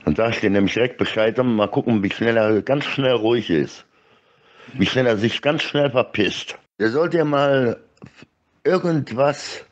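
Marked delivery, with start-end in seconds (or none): none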